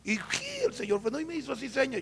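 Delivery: ordinary talk, nothing marked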